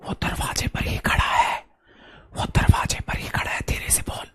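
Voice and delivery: hushed secretive whisper; breathless warning whisper. Acted. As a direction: whisper as if someone is at the door. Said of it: "आप ये dialogue नहीं बोलते?".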